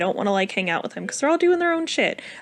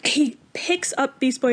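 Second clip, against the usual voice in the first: ordinary delivery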